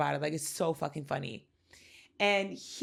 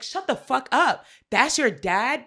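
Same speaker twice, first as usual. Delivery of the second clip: loud stunned "that's your dad?"; shocked disbelief; 6 semitones higher, 8 dB louder than usual. Retold or imitated. Whoopi stunned to hear who her dad is, loud shocked disbelief. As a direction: blurt it out in disbelief.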